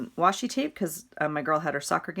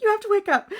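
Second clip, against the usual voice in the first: panicked plea; 6 dB louder, faster than usual. Retold or imitated. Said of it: "So I'm like".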